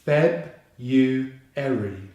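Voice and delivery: "feb, you, eri" in separated chunks; syllable by syllable. Shown as a demonstration. Teacher breaking February down for learners, syllable by syllable. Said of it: "with the stress on the first syllable".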